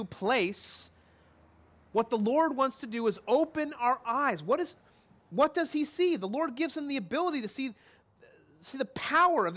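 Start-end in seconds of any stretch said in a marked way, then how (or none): none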